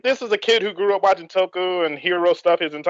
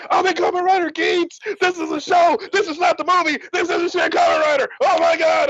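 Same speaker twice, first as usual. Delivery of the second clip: very high hammed-up excited shouting; mock hysterical joy, pitched way up; freaking out; 12 semitones higher than usual. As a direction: announce it to everyone in a squeal. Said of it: "I'm, like, freaking out".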